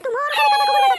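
furious yell. Acted. Angry wife cursing husband lying beaten. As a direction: spit the curse at him.

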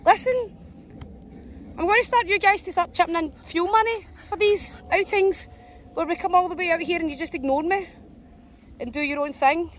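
1 mock scolding.

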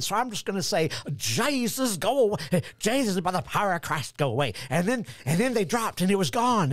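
high-pitched, hammed-up Southern yarn; mock Southern accent. Man recounting floating Coke bottles to lecturer, mock Southern accent. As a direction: tell it like an earnest country Southerner, exaggerated. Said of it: "I go right to that accent".

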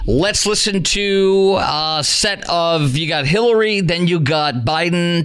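radio announcer. Acted. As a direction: stretch it out like a station ident. Station ident and segment introduction.

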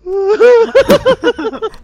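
high shrieking helpless laughter; crying with laughter, can't stop; hysterical laugh. Real, not acted. Friends in hysterics over game.

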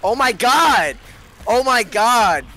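screaming in shock; shouting. Real, not acted. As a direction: yell out in alarm. Sudden death in game, screaming.

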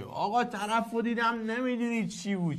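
a hint of a boaster spinning a yarn; mock braggart. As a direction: play a bragging guy exaggerating a tale.

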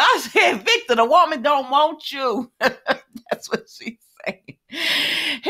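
high, amused jibe broken by laughter; real laughing. Real, not acted. Host teasing Victor that she doesn't want him, laughing. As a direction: tease him, then crack up and slow down.

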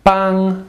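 "Bang" held high and steady; high level first tone. Shown as a demonstration. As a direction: sustain "Bang" on one high pitch.

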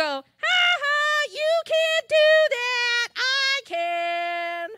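hammed-up teasing of a friend who can't do it; mean taunt. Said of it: "Do you go".

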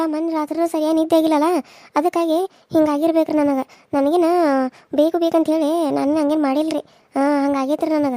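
put-on a young daughter-in-law fussing anxiously over her mother-in-law. Daughter-in-law defends herself. (ಆತಂಕದ ಸೊಸೆಯ ಎತ್ತರದ ಧ್ವನಿ)

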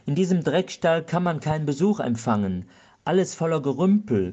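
storyteller's lively outraged cry; exasperated outcry. A narrator's reading. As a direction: rant at the filthy house. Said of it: "schrie er".

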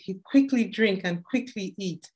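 usual speaking voice

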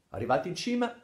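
plain reading voice

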